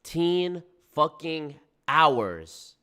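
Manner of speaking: very slow, each word stretched out; drawn-out disbelief. Real, not acted. Reacting to the fifteen-hour hike, slow disbelief. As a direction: let every word hang, incredulous. Repeats the clip's figure in disbelief.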